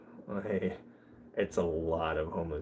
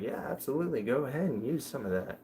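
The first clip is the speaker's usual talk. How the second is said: agreeable guy; 6 semitones higher, faster than usual. Retold or imitated. Acting out the guy lending soap.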